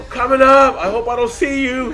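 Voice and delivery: high, loud hollered warning; slow, drawn-out call down the train; retold yell. Warning hidden riders he is coming up, retold yell. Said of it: "I yelled it, dude".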